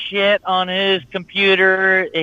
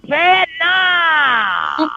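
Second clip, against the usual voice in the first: high, laughing shouted cheer; 11 semitones higher than usual. Acted. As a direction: belt it out like a cheer. Shouts the show name.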